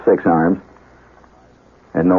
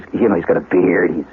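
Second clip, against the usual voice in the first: normal talking voice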